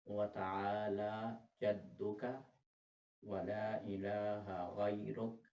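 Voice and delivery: सना recited slowly, word by word; slow recitation. Shown as a demonstration. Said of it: "सना पढ़नी है". (धीमी तिलावत)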